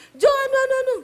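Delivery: loud, high-pitched calling out; slipping back into the loud high call; high Sulawesi call. Retold or imitated. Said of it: "keceplos lagi".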